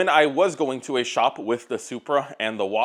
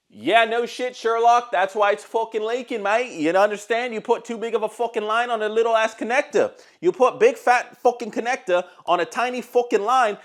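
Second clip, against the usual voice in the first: mock Australian accent, pitched high; a hammed-up Australian mocking the mechanic; 8 semitones higher than usual. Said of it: "Hopefully my Australian accent".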